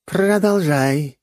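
high, slow regal command; majestic queen. Acted. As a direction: grant leave to speak like a queen. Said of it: "величественно позволила она".

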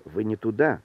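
ordinary delivery

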